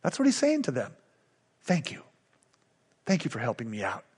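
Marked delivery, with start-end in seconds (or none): 1.6-4.0 much softer and lower, heartfelt thank you; tender thanks. Retold or imitated. Paul thanking the Philippians for their help, soft and heartfelt. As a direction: murmur the thanks gently, as if moved.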